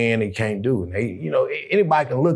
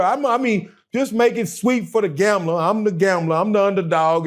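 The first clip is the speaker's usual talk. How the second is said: high, hyped fight-promo trash talk; Butcher Brown voice; 8 semitones higher than usual. Pretend. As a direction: boast like a hyped-up boxer cutting a promo. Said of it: "In my Butcher Brown voice".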